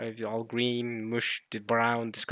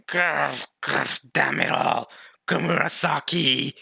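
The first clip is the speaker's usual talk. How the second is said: growling villain voice; Orochi; 3 semitones higher, 4 dB louder, slower than usual. Acted. Villain cursing the one who defied him, acted growl. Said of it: "Now Kaido's going".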